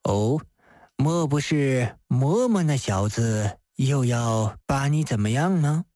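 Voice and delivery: low, probing magistrate voice; measured official questioning a flustered woman; Di Renjie. Acted. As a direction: ask with composed, low-pitched concern.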